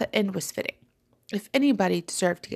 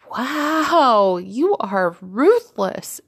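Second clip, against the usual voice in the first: slow, loud, drawn-out wow; amused amazement; 4 semitones higher, 7 dB louder, much slower than usual. A narrator's reading. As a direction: stretch it out in amused disbelief. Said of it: "Tranquilla laughed".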